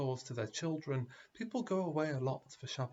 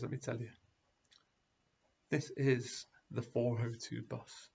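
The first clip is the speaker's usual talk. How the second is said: sly confession; 3 semitones lower than usual. Retold or imitated. Driver confides the truth.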